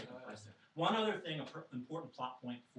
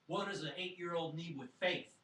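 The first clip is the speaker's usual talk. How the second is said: louder, higher scoffing question; exasperated retort; 6 semitones higher, 5 dB louder than usual. Retold or imitated. Father slamming the studio door on talk of faith, exasperated. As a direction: call it out in exasperation.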